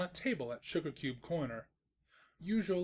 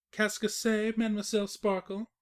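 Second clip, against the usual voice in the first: mock French; 7 semitones higher, 5 dB louder than usual. Narrator teasing in French.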